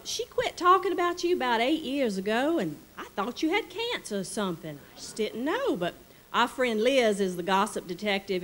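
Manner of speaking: high-pitched delighted Delta lady; Southern lady voice. Retold or imitated. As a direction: play the gushing Mississippi hostess. Charlotte confronts narrator gently.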